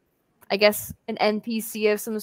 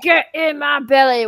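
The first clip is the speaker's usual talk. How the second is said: put-on Scottish accent; 4 semitones higher, 8 dB louder than usual. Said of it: "Michael Myers being obnoxious".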